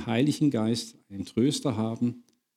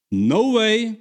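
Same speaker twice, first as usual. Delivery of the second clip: mock refusal; 7 semitones higher, 4 dB louder than usual. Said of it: "Petrus sagt, auf gar keinen Fall".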